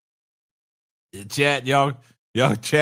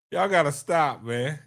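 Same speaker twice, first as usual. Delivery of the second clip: ordinary delivery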